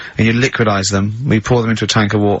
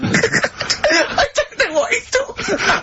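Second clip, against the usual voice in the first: laughing helplessly; slower than usual. Real, not acted. Host cracks up at Carl.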